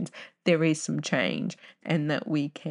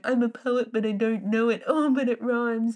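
higher, sing-song playground chant; schoolkid rhyme taunt; 6 semitones higher than usual. Retold or imitated. Kid at school mocking a rhyme in sing-song. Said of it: "we all went around going".

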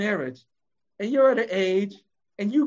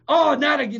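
mock exasperated groan; 7 semitones higher, 6 dB louder than usual. Acting annoyed listener at door.

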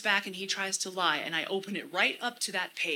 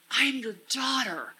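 DAUGHTER punched, the last word weighted; stress on DAUGHTER; 4 semitones higher than usual. Shown as a demonstration. Speaker meditating on being God's daughter, stressing DAUGHTER. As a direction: land firmly on the word DAUGHTER. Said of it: "emphasizing different words to really get that".

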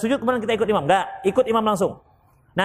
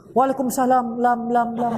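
echoing reply fading on "lam, lam, lam"; echoing in the head. Pretend. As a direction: let the last syllable ring like an echo. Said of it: "terngiang-ngiang suaranya". (gema suara yang terngiang-ngiang)

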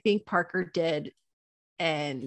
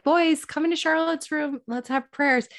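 higher-pitched summons; calling to kids; 8 semitones higher, 3 dB louder than usual. Retold or imitated. Mother calls boys for prayers, calling out. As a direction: call the boys in from down the hall.

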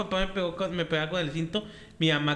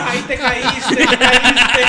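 put-on loud, laughing tease of a toddler who fell; mocking laugh; 6 semitones higher, 15 dB louder, much slower than usual. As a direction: laugh at the kid while you say it. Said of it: "Y luego".

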